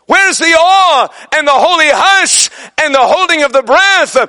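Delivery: high-pitched, impassioned pulpit cry; fervent preaching. Real, not acted. Preacher calling congregation to holy awe, fervent. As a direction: drive it to a ringing climax, stretching key words.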